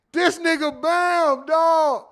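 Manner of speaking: loud, high and slow, laughing; slow loud high call to friend. Real, not acted. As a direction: drag out the name at him, laughing. Still laughing, calls out friend.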